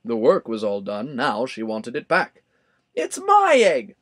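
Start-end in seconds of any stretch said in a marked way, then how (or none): 2.9-3.9 much higher, louder, slow indignant cry; sputtering bird. A narrator's reading. Maisie claiming the egg, sputtering angrily. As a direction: splutter with outrage. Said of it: "she sputtered".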